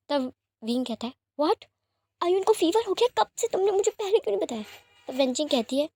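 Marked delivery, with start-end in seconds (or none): 1.4-4.6 suddenly frightened for his sick son; panicked shock. A narrator's reading. Father hearing his son has a fever, panicked. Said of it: "wing को panic देखकर".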